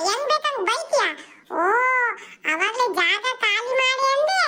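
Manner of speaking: put-on squabbling kids. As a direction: play kids trading insults and pulling hair. Kids quarrel continues.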